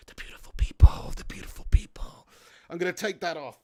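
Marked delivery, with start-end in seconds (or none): 0.1-1.1 whisper. Pretend. Repeats lyric in whisper. 1.2-2.3 mock creepy whisper. [name] look, whispering lyric.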